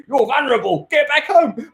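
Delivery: high put-on voice giving orders; bossy copper. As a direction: order him home like an officer. Acted jogger stopped by officer.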